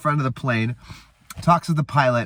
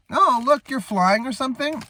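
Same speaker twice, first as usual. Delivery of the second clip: mock reaction; 10 semitones higher than usual. Quoting Owen Wilson's reaction in recap.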